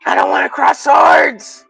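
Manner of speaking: high, shouted mock heroics; mock duel drama. Streamer refusing to fight Peter, in high shouted melodrama. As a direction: ham up the sword fight. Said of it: "And I'm like".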